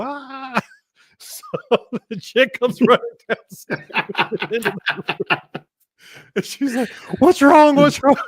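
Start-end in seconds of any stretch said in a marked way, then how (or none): 0.0-0.6 retold yell. Man scalded in the shower, mock yelling. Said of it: "just started yelling". 7.2-8.1 loud, high panicked impersonation; mock alarm. Woman rushing in to the yelling man, mock alarm.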